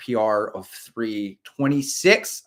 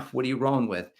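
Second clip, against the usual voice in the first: ordinary talk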